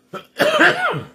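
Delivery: harsh hacking cough; smoker's cough. Real, not acted. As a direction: cough hard, chesty and rough. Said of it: "it's my smoker's cough".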